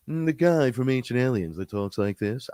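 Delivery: put-on TV alien-expert voice; mock the man from Ancient Aliens; Ancient Aliens guy impression. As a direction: imitate a familiar TV talking head. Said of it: "I do his voice".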